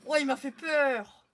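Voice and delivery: frightened high yell; scared scream. Real, not acted. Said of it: "j'ai crié".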